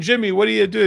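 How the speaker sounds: loud, high called-out question; shouting question. Retold or imitated. Host shouting to Jimmy on air, loud and high. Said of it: "So all of a sudden".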